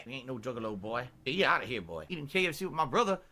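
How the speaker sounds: put-on southern accent; hammed-up old-time southerner scolding folks; Colonel Sanders. Streamer sparring with chat as Colonel Sanders, southern character voice. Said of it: "I'm still doing an accent".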